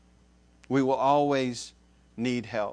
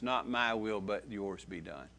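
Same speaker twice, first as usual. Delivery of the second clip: much softer, falling away to a murmur; hushed prayer; 6 semitones lower, 10 dB quieter than usual. Shown as a demonstration. Preacher voicing a prayer of surrender to God, hushed. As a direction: pray it softly, surrendering. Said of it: "to say in your prayers".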